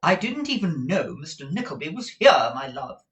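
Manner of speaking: a touch of a fond wife; Madame Mantalini. A narrator's reading. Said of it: "said Madame Mantalini".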